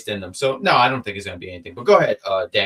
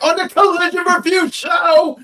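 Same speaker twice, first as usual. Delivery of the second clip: announcer voice; 6 dB louder than usual. Pretend. Mock show promo plug.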